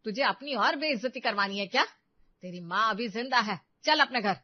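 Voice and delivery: loud, scornful scolding; angry command. Acted. Mother orders her humiliated daughter home, angry command.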